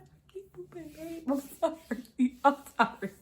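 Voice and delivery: high, whiny retold confession through laughter; mock-pitiful apology. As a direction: plead forgiveness, embarrassed and giggly. Embarrassed apology to partner.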